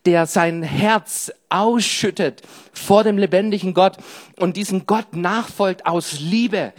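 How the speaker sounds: normal talking voice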